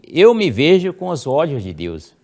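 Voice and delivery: first-person affirmation. Shown as a demonstration. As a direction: Eu me vejo said high and strong. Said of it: "você diga".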